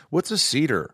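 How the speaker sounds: puzzled assistant. Retold or imitated. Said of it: "my assistant s-, thought it was".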